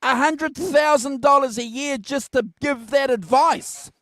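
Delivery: high, loud amazed outburst; excited exclamation. Real, not acted. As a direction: shout it in joking amazement.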